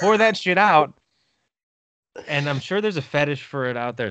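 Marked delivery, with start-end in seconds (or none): 0.0-0.9 louder repeat. Real, not acted. Echoed line, louder take.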